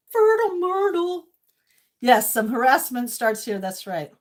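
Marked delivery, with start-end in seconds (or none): 0.1-1.2 playful sing-song. Real, not acted. Playful repeat.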